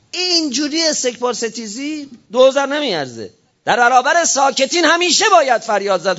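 high, forceful sermon voice; impassioned preaching. Real, not acted. Preacher condemning silence before a congregation, impassioned and high.

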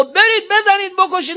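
very high-pitched quoted battle order; imitated rousing command. Preacher quoting the Imam's order to fight, high rousing command. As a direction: call out the order as if rallying fighters. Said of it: "امام به ما میگه".

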